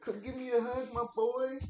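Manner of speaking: high, loud playful dad voice. Real, not acted. Dad asks his son for a hug.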